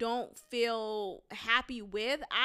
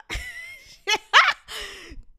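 loud laugh; 8 semitones higher, 6 dB louder than usual. Real, not acted. Laughing at Merla.

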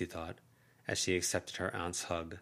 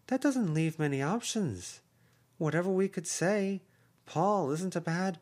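higher, lively caring aunt voice; voiced aunt, high and urging; effusive aunt; 8 semitones higher than usual. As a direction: coax her sister, pitched up and earnest. Aunt Heather offers support.

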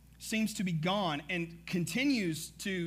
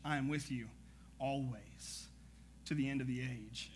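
lower, softer scripture reading; measured Bible reading voice; 6 semitones lower, 9 dB quieter than usual. Acted. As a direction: read the verses with quiet reverence. Reads scripture aloud.

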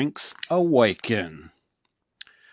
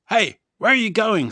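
high, loud call after the dog; chief calling out; 10 semitones higher, 6 dB louder than usual. A narrator's reading. Chief calling after running Dog Man, high and loud. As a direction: shout after him as he runs. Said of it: "says Chief".

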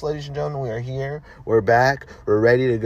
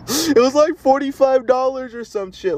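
laughing; 13 semitones higher than usual. Real, not acted. Laughing about the price.

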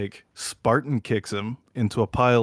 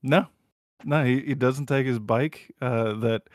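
usual speaking voice

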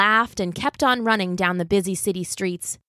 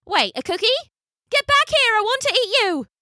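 excited shout. Acted. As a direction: shout after him, thrilled. Tourist realises and chases.

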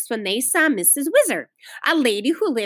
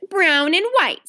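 put-on higher-pitched, ready child's reply; prompt answer; 6 semitones higher, 3 dB louder, slower than usual. A narrator's reading. Little Ramona answers the lady's question, promptly. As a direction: answer straight away in a higher voice. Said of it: "said Ramona promptly".

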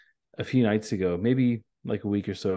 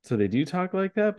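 mock surprise; 9 semitones higher than usual. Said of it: "and I was like".

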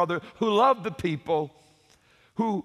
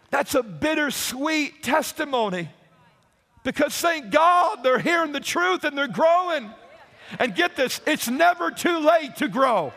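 fiery preaching; 3 semitones higher than usual. Acted. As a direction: preach it hard to the congregation. Preaching climax to the congregation.

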